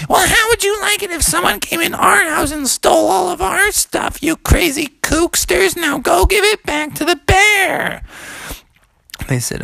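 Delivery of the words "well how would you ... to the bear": very high, outraged shouting; scolding mommy frog. Acted. Mom learns of stolen gold, scolding shriek. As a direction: shriek a telling-off like an angry mom.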